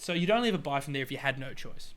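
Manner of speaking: usual speaking voice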